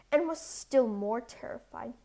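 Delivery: ordinary delivery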